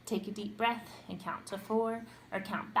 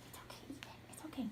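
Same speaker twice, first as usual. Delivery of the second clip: very soft whispered reassurance; soothing hush; 4 semitones higher, 15 dB quieter than usual. Real, not acted. Soothing the fussy little one.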